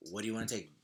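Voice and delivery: ordinary talk, nothing marked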